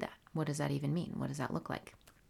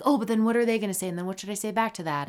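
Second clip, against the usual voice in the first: fast, pitched-up fretting; racing thoughts; 5 semitones higher, 4 dB louder, much faster than usual. Retold or imitated. Host voicing her brain preempting the whole conversation, racing thoughts. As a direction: rattle off worried questions in a rush. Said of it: "my brain is really going into".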